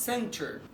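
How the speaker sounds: "center" with the T pronounced; standard English. Shown as a demonstration. Teacher giving the standard form, T sounded. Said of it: "في الستاندرد انجلش بتبقى".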